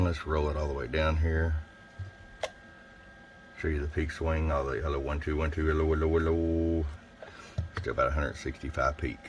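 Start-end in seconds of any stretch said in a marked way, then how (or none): none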